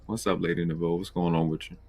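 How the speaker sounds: normal talking voice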